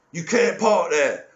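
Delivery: mock neighbor barking at him over parking space; angry neighbor. Angry neighbor complaining about parking, loud and slow.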